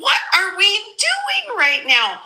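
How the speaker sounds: high, laughing gangsta grandma voice; high-pitched exasperated granny rant. Acted. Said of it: "You sound like gangsta grandma said that".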